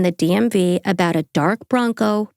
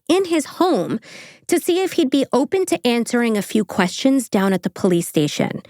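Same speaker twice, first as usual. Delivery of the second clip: normal talking voice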